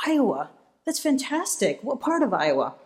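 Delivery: imitating a delighted president, pitch jumping up on fantastic; lightly voiced, beaming enthusiasm. President delighted to meet someone from Iowa, mock beaming. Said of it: "He starts beaming".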